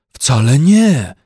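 slow loud bear protest. A narrator's reading. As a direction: protest firmly, little bear. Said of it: "zaprotestował Paddington". (protest misia)

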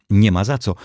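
usual speaking voice

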